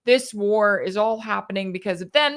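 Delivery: normal talking voice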